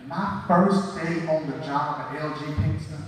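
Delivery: ordinary delivery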